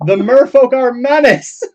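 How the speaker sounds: high, loud rabble-rousing cry; hammed-up street preacher shouting to the crowd; street preaching. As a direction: preach it at the top of your voice. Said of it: "like, preaching in the streets".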